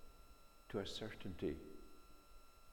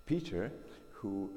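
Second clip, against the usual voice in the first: usual speaking voice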